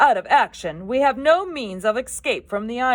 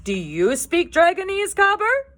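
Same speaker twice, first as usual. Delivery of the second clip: much higher, louder startled question; surprised; 14 semitones higher, 7 dB louder than usual. Acted. Stoick asking Gobber, surprised and much higher. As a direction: caught off guard by the idea. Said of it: "asked Stoke in surprise".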